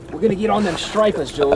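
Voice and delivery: high, hammed-up Boston accent. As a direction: ham up a Boston fisherman's accent. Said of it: "that Boston accent".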